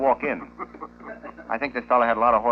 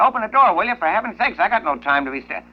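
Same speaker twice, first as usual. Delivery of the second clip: higher, louder, losing patience at the door; exasperated plumber; 8 semitones higher, 3 dB louder than usual. Acted. Plumber shouting at the parrot behind the door, exasperated and raised. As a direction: yell through the door, fed up. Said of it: "this gets more excitable".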